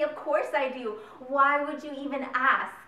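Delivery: loud, exasperated and slowing on the last words; exasperated outburst. Retold or imitated. To people asking if she remembers, loud exasperation. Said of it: "shake them by the shoulders and say".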